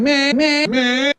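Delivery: high-pitched whine; high, bleating complaint; whiny kid. Retold or imitated. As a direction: mimic a whining child. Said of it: "the whiny kid going".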